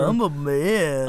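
smug ex voice. Retold or imitated. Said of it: "my voice for exes".

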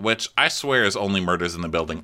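ordinary delivery

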